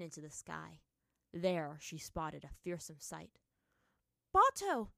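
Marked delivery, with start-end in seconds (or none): 4.3-4.9 high, loud warning yell. A narrator's reading. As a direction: yell the name across the battle. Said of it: "she yelled".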